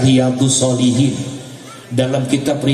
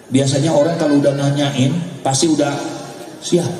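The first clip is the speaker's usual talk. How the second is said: ordinary talk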